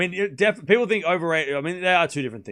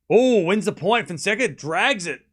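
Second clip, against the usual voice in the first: rising, much louder excited tennis call; excited commentator voice, higher and louder; live play-by-play; 7 semitones higher, 6 dB louder than usual. Acted. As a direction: call the rally as it happens, building up.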